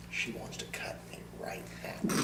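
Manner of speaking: whispered aside, unvoiced. Real, not acted. Joking aside about the host wanting to stop him, whisper. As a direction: whisper it behind her back. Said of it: "aside about host".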